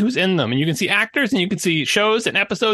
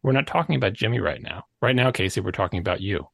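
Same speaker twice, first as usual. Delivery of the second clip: lower, quieter parental reprimand; scolding parent; 12 semitones lower, 4 dB quieter than usual. Retold or imitated. Teacher or parent shutting down the child's complaint, low and quiet. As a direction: talk down like a teacher cutting off excuses. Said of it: "response from any teacher or parent".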